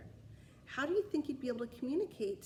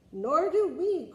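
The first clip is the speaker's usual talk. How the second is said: higher, louder, slow unison; knights in chorus; 6 semitones higher, 10 dB louder, slower than usual. Acted. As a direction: answer together like a row of knights. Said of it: "chorused the knights".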